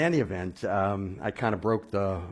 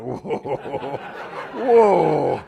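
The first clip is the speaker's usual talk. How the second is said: second whoa much higher and louder, laughing; 6 semitones higher, 8 dB louder than usual. Retold or imitated. Offered a tongue by the preacher, laughing startled whoa. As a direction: back away laughing in disbelief.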